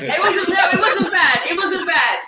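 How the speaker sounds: high, loud, laughing protest; laughing reassurance. Real, not acted. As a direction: reassure them through real laughter. Laughing reassurance about fake accent.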